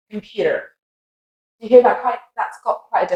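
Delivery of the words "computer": American computer, "pyu-der"; softer computer with tapped T and R, American; American accent. Shown as a demonstration. Teacher contrasting computer with British, American accent. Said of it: "with the American accent".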